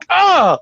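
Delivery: high, loud imitated scream; startled scream. Retelling wife scaring husband in shower, startled scream. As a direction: let out a sudden mock scream. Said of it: "She scared the fuck out of me".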